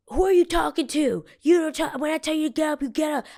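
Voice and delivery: high, loud imitation of a barking guard; a high, loud mock shout of the guard's order. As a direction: shout him down like an angry guard. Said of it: "And he's like".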